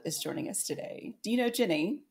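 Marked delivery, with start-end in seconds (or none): 1.2-2.0 put-on British accent. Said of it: "that was the best British accent".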